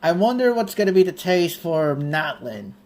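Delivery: usual speaking voice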